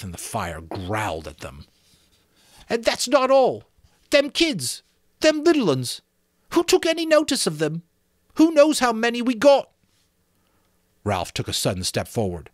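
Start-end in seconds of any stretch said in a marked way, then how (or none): 2.7-9.7 put-on high, far-off fretful boy's voice, well above the narrator; a put-upon, indignant schoolboy, much higher; Piggy's whiny voice. Piggy scolding the boys for rushing off, high indignant boy voice. Piggy complains about little ones.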